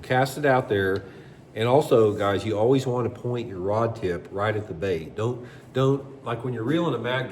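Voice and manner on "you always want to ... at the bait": usual speaking voice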